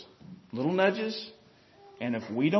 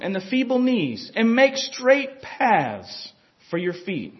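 scripture reading; 3 semitones lower than usual. Acted. Reads scripture aloud.

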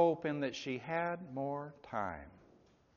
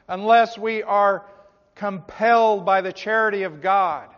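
loud, driving sermon delivery; forceful preaching; 6 semitones higher, 9 dB louder than usual. Real, not acted. Preacher warning a congregation they can miss it, forceful preaching. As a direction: press each clause home with rising urgency.

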